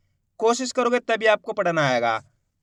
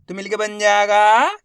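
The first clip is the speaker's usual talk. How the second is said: normal talking voice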